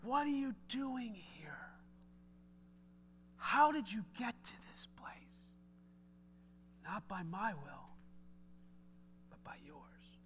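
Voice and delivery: unvoiced, slow, gentle whisper; God's whisper. God whispering to Jeff in his anger. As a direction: ask it softly, like God leaning in. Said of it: "He goes".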